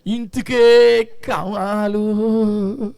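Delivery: stretched-out whiny plea, very slow; hammed-up crying prayer, mocking a churchgoer; mock wailing prayer. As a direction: drag every syllable like a self-pitying beggar. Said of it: "ఒకటే ప్రార్థన, ఏమనంటే".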